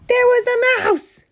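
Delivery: high, loud squeal of surprise; storyteller shrieking in mock alarm; shocked discovery. A narrator's reading. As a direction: cry it out in astonishment. Narrator's shock, mouse found again.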